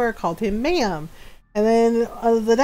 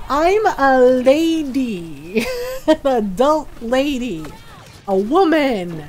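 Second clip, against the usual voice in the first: LADY stretched out slowly; drawn-out correction; slower than usual. Retold or imitated. Woman correcting a telemarketer, slow emphatic correction. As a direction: draw out "lady" to make the point.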